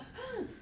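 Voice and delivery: a sharp high gasp of fright; startled gasp. Shown as a demonstration. Teacher showing the class a startled gasp, sharp and high. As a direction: suck in air like someone just jumped at you.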